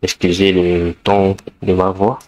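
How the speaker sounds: ordinary talk, nothing marked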